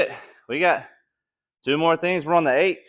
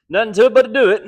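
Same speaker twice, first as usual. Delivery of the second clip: pumped up; 7 semitones higher, 6 dB louder, faster than usual. Real, not acted. Pumping himself up mid-workout.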